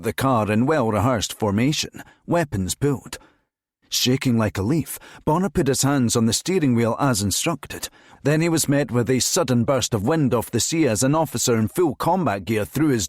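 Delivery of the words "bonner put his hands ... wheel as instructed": ordinary delivery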